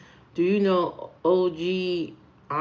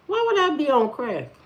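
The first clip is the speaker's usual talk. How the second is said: higher-pitched indignant question; affronted, voice pitched up; 6 semitones higher than usual. Real, not acted. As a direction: throw the accusation back in disbelief. Indignant reply to crack comment.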